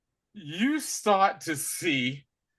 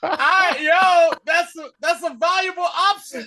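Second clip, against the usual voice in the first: higher and louder, laughing through the words; laughing while talking; 11 semitones higher, 8 dB louder than usual. Real, not acted. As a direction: get the words out between laughs.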